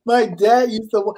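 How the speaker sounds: ordinary delivery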